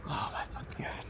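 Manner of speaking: exasperated whisper under breath; breathless whispered disbelief. Real, not acted. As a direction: hiss it to yourself. Exasperated at sticky fish.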